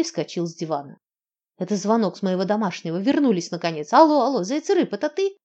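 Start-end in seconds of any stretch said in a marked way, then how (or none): 1.6-5.4 loud, rising, overjoyed cry; joyful shout. Acted. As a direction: yell it out with delight. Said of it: "радостно завопил он".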